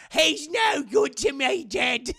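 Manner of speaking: loud, high, slow Cockney shout; Cockney Boba Fett. Shown as a demonstration. Streamer showing his failed New Zealand accent comes out Cockney. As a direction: ham up a bad Cockney accent. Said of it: "He'll sound Cockney".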